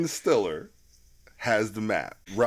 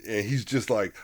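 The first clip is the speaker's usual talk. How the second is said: normal talking voice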